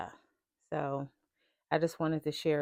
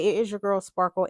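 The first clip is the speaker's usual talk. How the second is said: ordinary talk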